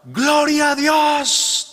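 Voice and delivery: drawn-out, high joyful shout; a long, high, jubilant shout of praise. Acted. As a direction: cry it out slow and high from the doorstep. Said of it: "y gritaba".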